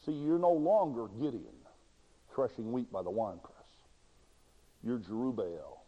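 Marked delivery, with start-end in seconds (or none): none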